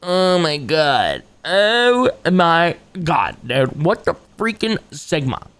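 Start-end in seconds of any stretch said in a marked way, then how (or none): none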